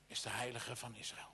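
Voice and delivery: normal talking voice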